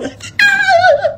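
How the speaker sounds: high falling laugh; real amused laughter; high cackle. Real, not acted. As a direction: burst and drop. Laughing at viewer reactions.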